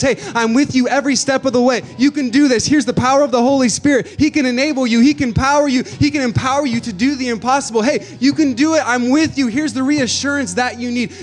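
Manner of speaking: rising pulpit fervour; preaching at full force. Acted. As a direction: preach it to the back row.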